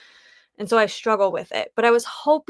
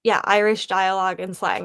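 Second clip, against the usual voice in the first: ordinary talk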